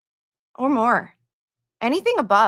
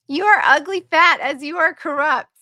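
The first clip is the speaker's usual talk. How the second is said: incredulous reading; 5 semitones higher, 6 dB louder than usual. Real, not acted. Reading harsh comment aloud.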